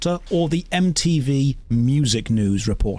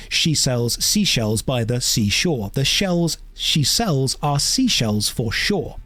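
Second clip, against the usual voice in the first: slow tongue twister; slower than usual. Shown as a demonstration. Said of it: "saying things like".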